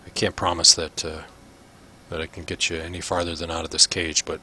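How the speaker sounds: low, hushed guard making a secret offer; konspiracyjny strażnik. Acted. GM voices guard Drolin.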